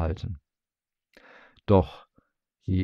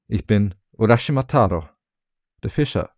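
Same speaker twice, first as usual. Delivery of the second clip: louder, proclaiming his name; calling out; 2 semitones higher, 6 dB louder than usual. A narrator's reading. Fisherman declares his name to the old man, loudly. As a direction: announce who you are, raising the voice. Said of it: "rief Urashima".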